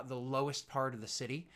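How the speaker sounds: usual speaking voice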